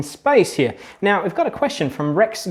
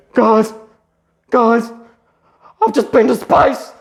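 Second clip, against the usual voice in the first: high, loud, breathless-with-news dog voice; hammed-up excited shout, pauses between calls; excited announcement; 7 semitones higher, 6 dB louder, slower than usual. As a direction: play an excited dog bursting to tell everyone. Voicing the returned dog's announcement.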